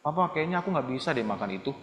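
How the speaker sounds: soft, hesitant courteous excuse; polite, gentle child. Acted. Child explaining to papa, softly and politely. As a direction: explain meekly to your father why not. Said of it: "kamu harus ngomong baik-baik".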